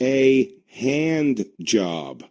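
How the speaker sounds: very slow, word by word; deliberate verdict. Real, not acted. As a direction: deliver the insult slowly for the punchline. Drawn-out verdict on the son.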